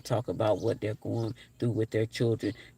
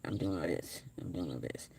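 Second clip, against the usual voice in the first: low-energy murmur; 5 semitones lower, 11 dB quieter than usual. Real, not acted. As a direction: drop much lower and softer, tired and worn out. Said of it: "You probably can hear it in my voice".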